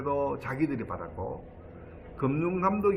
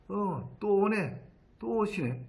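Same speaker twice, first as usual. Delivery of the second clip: much higher-pitched, drawn-out weary recognition; imitated policeman; 8 semitones higher, slower than usual. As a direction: act out an officer seeing yet another victim. Acts out police greeting another victim. (높고 늘어지는 경찰관 말투 흉내)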